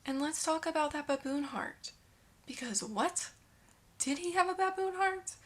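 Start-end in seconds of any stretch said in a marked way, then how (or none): 2.5-5.3 incredulous. Real, not acted. Incredulous about the baboon heart.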